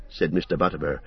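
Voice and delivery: usual speaking voice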